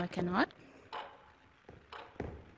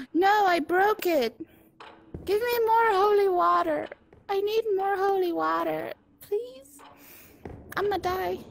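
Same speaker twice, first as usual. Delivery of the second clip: panicked pleading; 6 semitones higher than usual. Real, not acted. Breaks holy water, begs.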